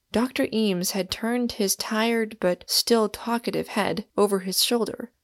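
usual speaking voice

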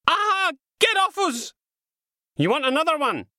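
high, loud yelling; shouting in a scuffle. Acted. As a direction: shout through the struggle. Violent scuffle against the wall.

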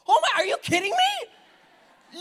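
high, loud disbelief; shocked outcry. Real, not acted. Pastor reacting to the congregation's vote, high and shocked. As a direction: throw up your hands in disbelief.

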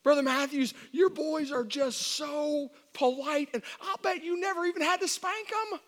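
high, loud, drawn-out mock admiration; gushing church member. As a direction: imitate a fawning parishioner in a high voice.

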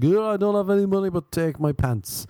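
high, hurried mock panic, slowing at the end; hammed-up scared surrender. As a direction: play a frightened mugging victim. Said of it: "make me scared".

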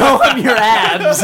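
laughing mock of the teasing call; laughing tease. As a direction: repeat the dare through laughter.

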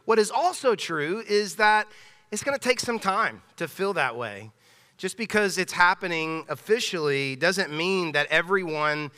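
ordinary talk

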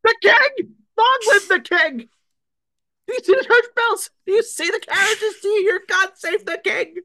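very high, loud proclamation voice; town crier. Pretend. Announcing the king like a crier.

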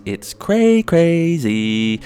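high, very slow silly drawl; drawn-out exclamation. Acted. Comedian stresses how wild the show is, slow silly drawl. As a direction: stretch out crazy.

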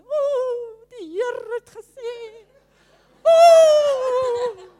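high, loud mock-prophetic wailing with drawn-out ohs; floaty, silly prophesying. Shown as a demonstration. Minister showing how not to prophesy, hammed-up wail. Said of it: "Now, I don't say to her".

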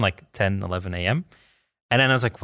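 normal talking voice

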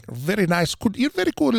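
bad Scottish accent. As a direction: attempt a Scottish accent and miss badly. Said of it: "That was a horrible accent".

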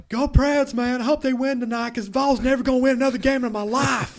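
loud, high East Tennessee fan, laughing; East Tennessee accent. Retold or imitated. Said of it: "Your, your East Tennessee accent's pretty good".

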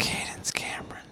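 hushed, voiceless whisper; whispered echo. Pretend. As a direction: whisper the name softly. Echoing the name in a whisper.

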